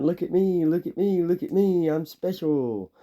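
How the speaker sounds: high, whiny mock voice of an attention-seeker; mock hollering. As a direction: squeal it like you're mocking someone begging for attention. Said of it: "two percent of society that's just hollering".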